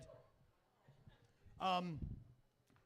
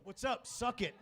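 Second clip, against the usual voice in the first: brash cocky greeting, a bit louder; 4 semitones higher, 5 dB louder than usual. Retold or imitated. Comic retells greeting his blind date, cocky. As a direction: greet her like a cocky jerk. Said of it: "I go".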